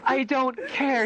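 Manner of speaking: high, very drawn-out mock exasperation; slow, high-pitched brush-off; exasperated dismissal. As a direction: stretch out each word, imitating a fed-up official.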